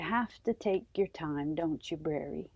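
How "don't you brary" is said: ordinary delivery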